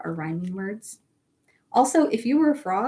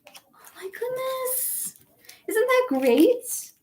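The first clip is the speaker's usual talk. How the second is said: high, slow delighted gasp; 13 semitones higher, slower than usual. Real, not acted. Teacher gushes to the class about the story's happy ending. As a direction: gush over the happy ending.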